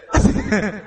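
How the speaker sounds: a short real laugh. Real, not acted. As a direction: let out a brief laugh. Laughing at own story.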